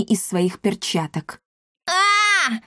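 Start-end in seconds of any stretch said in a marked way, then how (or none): 1.9-2.5 high-pitched drawn-out yell; high wail of pain; frantic yowl. A narrator's reading. Cat struck by lightning, yelling frantically. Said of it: "истошно завопил кот".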